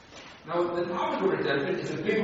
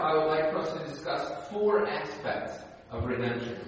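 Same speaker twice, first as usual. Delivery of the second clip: ordinary delivery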